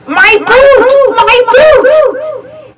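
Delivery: high, loud, eerie wailing ghost. A narrator's reading. Ghost of Red Boots wailing for her boot. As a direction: wail it like a ghost.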